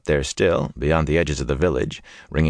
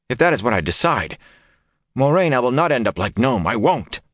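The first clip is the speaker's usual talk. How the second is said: indignant protest; 6 semitones higher than usual. A narrator's reading. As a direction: push back hard, rattled. Perrin protests in alarm.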